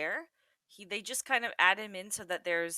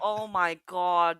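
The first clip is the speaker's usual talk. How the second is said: loud disgusted groan; 9 dB louder, slower than usual. Real, not acted. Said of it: "that was so".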